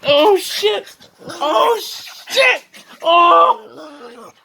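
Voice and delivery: high loud laughing shouts; startled shouting. Real, not acted. Owner shouting and laughing as the dog bites his hands. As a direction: shout as the teeth catch you.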